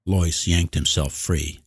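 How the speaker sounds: plain reading voice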